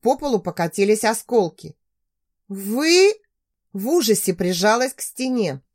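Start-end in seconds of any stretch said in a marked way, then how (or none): 2.5-3.1 shocked. Acted. Shocked at the intruder.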